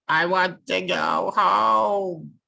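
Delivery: high, loud, slow wail. Acted. Chook on the mountain wails to go home. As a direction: draw it out in a long wail. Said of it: "wailed Chook".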